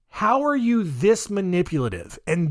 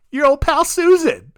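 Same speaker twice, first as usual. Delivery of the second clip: higher, louder mock announcement; playful grand reveal; 9 semitones higher, 5 dB louder than usual. [name] reveals resurrected Susan to followers, mock grand reveal. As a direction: present a surprise guest in a hammed-up way.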